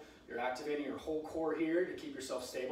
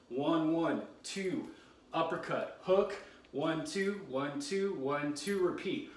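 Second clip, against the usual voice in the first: boxing coach calling combos; slower than usual. Real, not acted. Coach calls boxing combo live.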